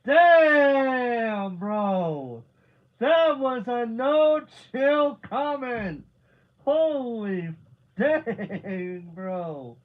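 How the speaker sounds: loud, high hollering with laughter; shocked, delighted outburst. Real, not acted. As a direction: holler in disbelief at a savage burn, stretching the words. Reacting to a brutal burn.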